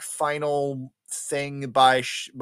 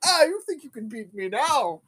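high mock villain taunt, getting louder; Spider-Man; 11 semitones higher, 7 dB louder than usual. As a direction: crow it like a cartoon villain. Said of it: "he's like".

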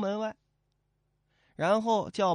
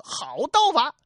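mock praise shout; 9 semitones higher, 6 dB louder than usual. Officer's fake praise in fight.

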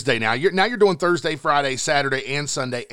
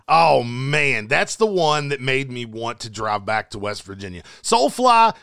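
hype lineup announcement; 5 semitones higher, slower than usual. Acted. Host reading the metal festival lineup to listeners, hyped announcer. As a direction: sell every band name like a festival announcer.

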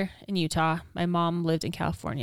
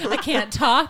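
ordinary talk, nothing marked

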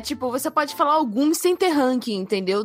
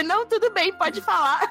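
higher mock-innocent voice; mocking friend; 7 semitones higher than usual. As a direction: imitate her fake calm. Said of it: "Você". (imitando a amiga, voz aguda)

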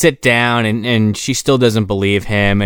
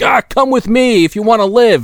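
high, louder hammed-up hero line; mock action hero; 13 semitones higher, 4 dB louder than usual. Podcaster mocks the hero's rescue line, hammed-up and high. Daniel Baldwin quoted, high and loud.

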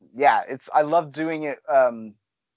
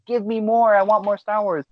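put-on higher, excited little kid begging; mock a thrilled kid wanting more; eager kid; 8 semitones higher, 5 dB louder than usual. Five-year-old begging his dad for more Star Wars, excited.

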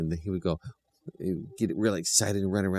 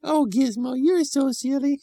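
put-on sweet children's voice; 6 dB louder than usual. A narrator's reading. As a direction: coo at the puppy like a small child. Children scold puppy fondly.

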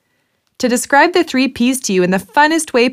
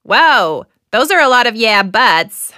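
game show host; 4 semitones higher than usual. Acted.

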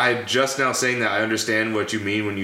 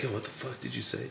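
hushed, very soft imitated challenge; retold confrontation in a near whisper; whispered threat; 13 dB quieter than usual. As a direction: say it under your breath, right in his face. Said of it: "Did he whisper it like this?".

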